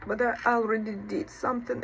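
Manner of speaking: usual speaking voice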